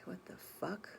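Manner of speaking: unvoiced, very soft what-the-fuck; whispered disbelief. Woman whispering her shock after overhearing. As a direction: breathe it out under your breath in shock. Stunned inner reaction, whispered.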